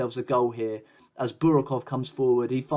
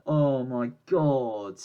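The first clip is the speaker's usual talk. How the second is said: despairing groan; much slower than usual. Real, not acted. Team knocked out of tie.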